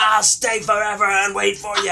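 high, loud drawn threat; angry mock yell. Driver threatening to wait out kids, hammed-up shout. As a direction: vow loudly to outlast the hiders. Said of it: "he's just yelling".

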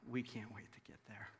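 awed whisper after a tearful stranger; hushed whisper. Retold or imitated. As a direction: breathe it out, overwhelmed with emotion. Moved after the man weeps.

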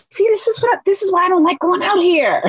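exasperated. Retold or imitated. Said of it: "But I was like".

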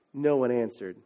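ordinary delivery

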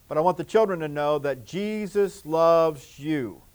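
usual speaking voice

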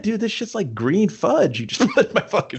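high, sneering villain voice; mock accusation. Shang Tsung accusing Quan Chi over the green drink, mock sneer. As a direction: call out the poisoner with contempt.

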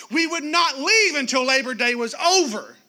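normal talking voice

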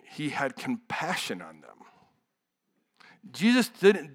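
ordinary talk, nothing marked

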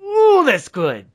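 high, loud, drawn-out delight; bowled over, stretching the words; thrilled reaction. Real, not acted. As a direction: swoop down from a high appreciative ooh. Delighted reaction to the story's ending.

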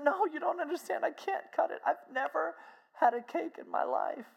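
high, overcome female voice; imitated tearful pleading. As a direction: plead as if moved to tears. Overwhelmed, tearful woman pleads.

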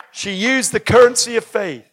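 ordinary talk

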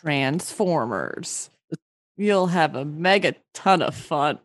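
movie voice. Acted. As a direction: boom it like a blockbuster ad. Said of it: "do it in a movie voice".

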